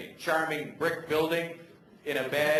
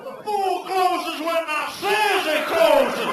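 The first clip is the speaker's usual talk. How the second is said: much higher, loud, slow shouted voice; angry American pool guy; 7 dB louder, slower than usual. Acted. US pool guy refusing to leave, high slow angry shout. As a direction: bellow it like a furious pimp.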